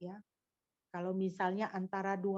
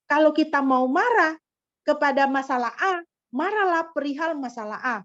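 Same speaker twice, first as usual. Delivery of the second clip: preaching emphasis; 3 semitones higher, 9 dB louder than usual. Real, not acted.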